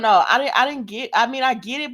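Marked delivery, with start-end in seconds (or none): none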